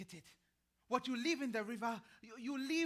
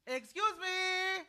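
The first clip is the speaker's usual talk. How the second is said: raised, drawn-out call for attention; 9 semitones higher, 6 dB louder, slower than usual. Acted. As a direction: call out to get their notice. Old woman calling for attention.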